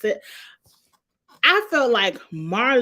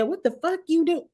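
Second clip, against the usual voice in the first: quoting Kenya; 8 semitones higher than usual. Retold or imitated. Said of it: "Kenya said".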